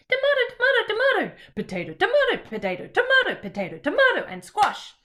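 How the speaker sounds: very high, loud squeaky tomato voice. Shown as a demonstration. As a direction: shoot way up high on every tomato. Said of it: "the tomatoes in a high, um, in a high voice".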